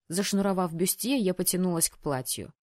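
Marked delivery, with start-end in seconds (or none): none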